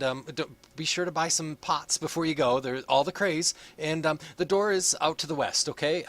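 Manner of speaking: high, chirpy brush-off; high-pitched polite dismissal. Acted. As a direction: sweetly hurry them out the door. Same character brushing them off.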